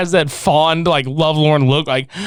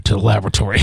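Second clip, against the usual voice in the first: dramatic villain; 10 semitones lower, much faster than usual. Retold or imitated. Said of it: "Devin's like".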